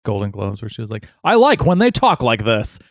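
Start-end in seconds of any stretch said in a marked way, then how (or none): 1.2-2.8 high-pitched put-on accent bit; Amy Poehler. Retelling a Golden Globes joke, high put-on accent. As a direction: imitate a comedian's hammed-up accent routine. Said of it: "I like the one Amy Poehler did".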